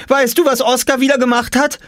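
very high, shrill tattling; calling out. A narrator's reading. Jule tells on Oskar to father at the car, calling out. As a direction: call out your complaint urgently. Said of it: "rief Jule".

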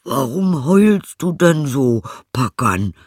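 high, loud, drawn-out question; donkey character. Acted. Donkey asks the howling hound what's wrong, high donkey voice. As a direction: voice a donkey calling out, slowly.

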